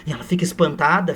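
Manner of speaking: normal talking voice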